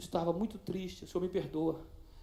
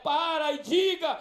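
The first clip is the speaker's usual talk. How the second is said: slow, rising, louder sermon build; fiery preaching; 4 semitones higher, 5 dB louder, much slower than usual. Real, not acted. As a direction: preach it harder and higher until you shout. Preaching climax to congregation.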